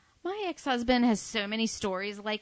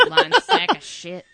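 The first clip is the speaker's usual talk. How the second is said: louder, high, slow, laughing it out; laughing insult; 7 semitones higher, 8 dB louder, slower than usual. Real, not acted. Joking about her lying ex-husband, laughing loudly. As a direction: toss the insult through a laugh.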